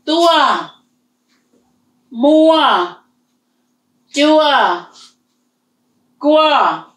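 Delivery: each word said high and clear; tone drill. Shown as a demonstration. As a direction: list Tua, mua, jua, gua with a high tone. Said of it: "what word are we come up with?".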